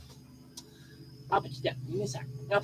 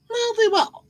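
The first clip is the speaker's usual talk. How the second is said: mock grudging praise; 14 semitones higher, 3 dB louder than usual. Real, not acted. Mock praise repeated high.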